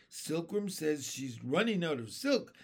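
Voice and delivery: plain reading voice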